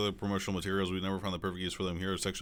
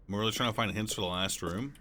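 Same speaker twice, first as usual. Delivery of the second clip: ordinary talk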